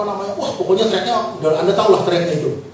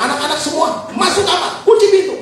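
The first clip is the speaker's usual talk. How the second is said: high call building to a shout; retold emergency order, louder at the end; acted urgent command; 12 semitones higher, 4 dB louder than usual. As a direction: order children to safety, climbing in pitch. Acts himself ordering children inside.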